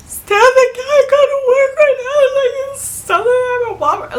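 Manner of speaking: hammed-up tearful phone plea to the boss; hysterical crying. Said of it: "I'm hysterical".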